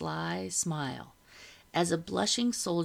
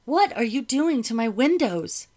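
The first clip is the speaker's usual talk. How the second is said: high, loud indignant demand; 6 semitones higher, 8 dB louder than usual. A narrator's reading. Madam confronting soldiers over her windows, demanding. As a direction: demand an explanation, voice raised high. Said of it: "Madam demanded".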